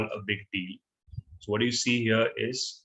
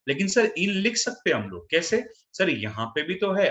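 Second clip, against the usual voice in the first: higher-pitched mock student protest; imitated student objection; 7 semitones higher than usual. Teacher voicing a weaker student's objection to sir, higher-pitched protest. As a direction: mimic a confused student arguing back, pitched up. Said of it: "कुछ लोग below average students होते हैं".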